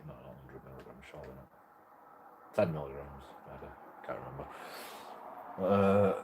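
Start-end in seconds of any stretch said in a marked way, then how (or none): 0.1-4.5 muttered aside. Real, not acted. Muttered self-correction.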